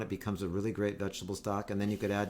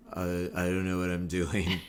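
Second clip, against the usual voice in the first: imitated California dude; 4 semitones lower than usual. Repeats the truck guy's line.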